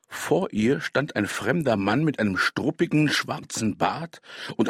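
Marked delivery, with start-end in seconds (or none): none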